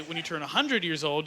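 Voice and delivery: ordinary talk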